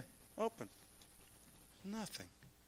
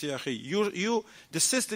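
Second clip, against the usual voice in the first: ordinary talk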